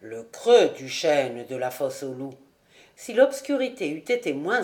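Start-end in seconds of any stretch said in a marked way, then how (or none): 0.0-2.3 a deep, certain reply; low, knowing charcoal burner. A narrator's reading. Rouan confirming the hiding place to Jude, low and sure.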